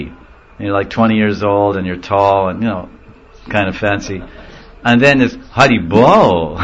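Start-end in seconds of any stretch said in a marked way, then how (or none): 5.5-6.6 slow swooping Hari Bol; mock admiring greeting to a lady; flirtatious shopkeeper. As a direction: draw the greeting out suggestively, rising then falling. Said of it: "you're, like, a lady".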